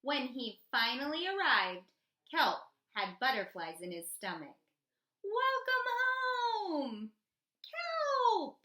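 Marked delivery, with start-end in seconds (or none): 5.2-8.6 slow, much higher, louder welcome call; joyful greeting. Acted. Friends greeting Kelp on his return, calling out joyfully. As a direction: call out happily across the water.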